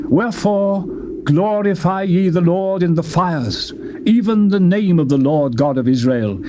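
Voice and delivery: plain reading voice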